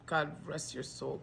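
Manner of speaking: usual speaking voice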